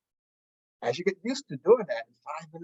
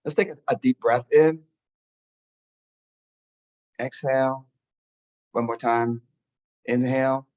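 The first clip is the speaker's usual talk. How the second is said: guided meditation; 2 semitones lower than usual. Acted. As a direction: talk them gently into stillness. Leads a meditation.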